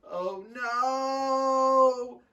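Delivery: high, long-drawn cry of dread; dismayed wail. Acted. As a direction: stretch it out as doom dawns. Caught laughing by the maid.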